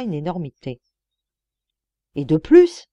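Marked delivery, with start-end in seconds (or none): 2.2-2.9 relance pressante, plus forte, montée finale; pousse-le à finir sa phrase; insistant. A narrator's reading. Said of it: "insista le juge intrigué". (le juge intrigué relance Lecoq, insistant et plus fort)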